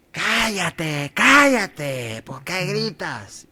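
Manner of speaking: high, drawn-out mock scolding; acted scolding crowd. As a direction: hush him crossly, stretching the words. Acting crowd hushing the blind man.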